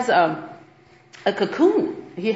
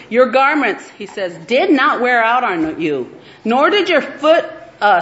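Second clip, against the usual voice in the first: louder, measured scripture reading voice; 2 semitones higher, 5 dB louder than usual. Acted. Preacher reading Deuteronomy to her sisters, proclaiming. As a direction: proclaim the verses to the congregation. Reads scripture passage aloud.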